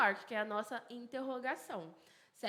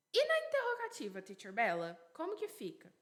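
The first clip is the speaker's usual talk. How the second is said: imagined student asking. Acted. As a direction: pipe up like a pupil interrupting the teacher. Voices a student asking the teacher. (aluno perguntando, em tom agudo e apressado)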